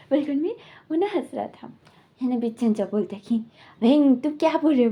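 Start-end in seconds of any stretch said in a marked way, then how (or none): 3.8-4.9 louder mock yell at Wing; retold yell. Uncle yelling at Wing over the child, loud. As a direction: snap out the question, half-shouting. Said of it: "बिल्कुल भी चीखते हैं।".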